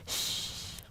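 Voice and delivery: soft, voiceless shush; very quiet drawn-out shh; hushing. Acted. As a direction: hush them secretively. Character hushes the other.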